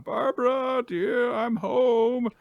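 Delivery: put-on high, drawn-out old-man call. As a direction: call to a wife through the house. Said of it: "he starts calling out".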